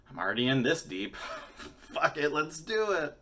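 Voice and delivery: giddy fuck-it surge; reckless glee. Retold or imitated. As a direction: throw caution away with a laugh. Deciding to smoke again.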